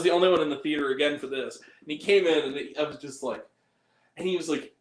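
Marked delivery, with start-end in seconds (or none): none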